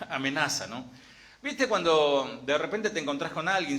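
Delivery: ordinary delivery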